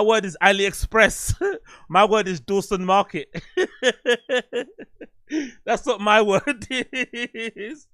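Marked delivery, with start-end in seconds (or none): 3.4-5.0 high laugh breaking out at his own joke; burst of laughter. Real, not acted. Laughing at his own bargain-shop list. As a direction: crack up at the punchline.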